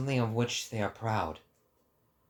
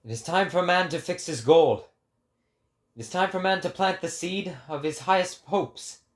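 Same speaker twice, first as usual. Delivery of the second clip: higher, louder, grand declamation; proclaiming prophet; 8 semitones higher, 6 dB louder than usual. Acted. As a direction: preach it to the crowd like a prophet. Prophet preaching resumes.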